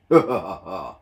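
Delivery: low gloating engine chuckle. A narrator's reading. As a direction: chuckle smugly. Gordon gloating.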